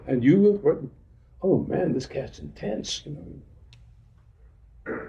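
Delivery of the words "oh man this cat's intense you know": fading to a breathy whisper; hushed, as if the man is too much to handle; mock hushed awe. Friend gossiping that the speaker is intense, fading whisper. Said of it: "Can't talk like that".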